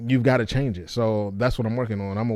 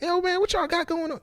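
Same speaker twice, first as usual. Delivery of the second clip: much higher, far from own, loose and friendly; higher-pitched voice. Shown as a demonstration. Practicing a livelier stage voice, very high and friendly. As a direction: jump the pitch way up and sell a casual greeting. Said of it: "gotta change myself and be like".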